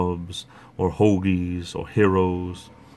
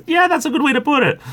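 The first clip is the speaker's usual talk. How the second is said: laughing; 5 dB louder than usual. Real, not acted. Laughing at a chat comment.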